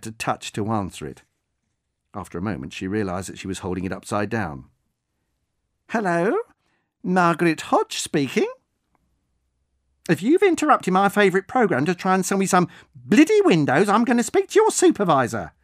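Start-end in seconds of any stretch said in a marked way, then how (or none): 5.9-15.5 put-on much higher and louder, a prim elderly lady on the warpath; indignant old woman. Old woman threatening a caller with his supervisor, indignant and shrill-pitched loud. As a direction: bristle at a cold caller. Margaret answers the phone.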